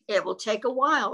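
ordinary delivery, nothing marked

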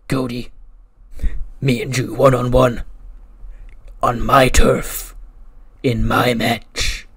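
loud, drawn-out wrestling promo; menacing challenge. Retold or imitated. Wrestler challenging rival one-on-one, loud slow menace. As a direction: hammer each phrase slowly and loudly.

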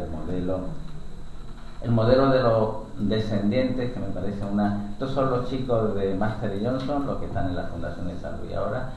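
ordinary talk

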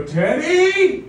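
loud, high call of the name; shouting downstairs. Retold or imitated. Mom shouting her son's name down the stairs. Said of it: "shout downstairs".

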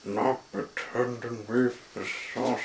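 ordinary delivery

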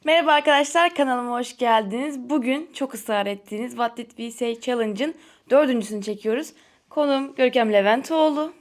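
loud, bright host greeting; energetic vlog intro. Acted. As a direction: welcome viewers like a YouTube host. Opens the channel video.

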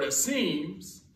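"seems" drawn out with a lifted tone; stress on seems. Shown as a demonstration. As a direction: lean on "seems" and let it rise. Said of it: "emphasize that word".

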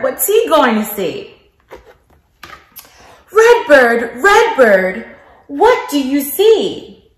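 lilting picture-book narration with big questions; reading to children. Acted. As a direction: read it like circle time for little kids.

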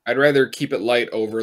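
ordinary talk, nothing marked

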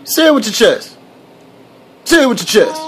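high, falling play-confrontational demand; mock challenge. Adult daring a babbling baby, mock confrontational.

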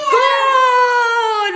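high excited goal shout with laughter; screaming football commentator. Pretend. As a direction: call the goal like a stadium announcer. Calling the goal.

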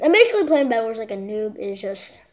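ordinary talk

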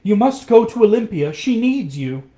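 high, loud plea; urgent cry. A narrator's reading. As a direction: cry it out, urging him to go. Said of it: "she cried".

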